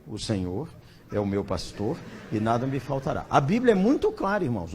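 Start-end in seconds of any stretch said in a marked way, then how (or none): 0.1-3.3 lower, softer, dragging drone of the verse; dull droning version. Retold or imitated. How the bored brothers heard the sermon, low soft drone. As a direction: make the verse sound endlessly boring. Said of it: "Como os irmãos ouviram".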